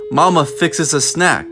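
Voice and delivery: ordinary delivery, nothing marked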